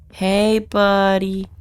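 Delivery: high, slow drawn-out call; sing-song coaxing. Real, not acted. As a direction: coax the monster sweetly. Calling to the monster.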